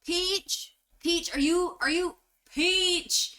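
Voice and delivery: high, drawn-out call for someone. Acted. Host calls for the ride operator, high searching call. Game master calls for Peach.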